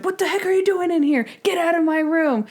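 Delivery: high-pitched mock outrage; cranky old man picking a fight. Old patient to an intruder in his room, mock-angry. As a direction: imitate a crotchety man throwing someone out. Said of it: "who starts picking a fight".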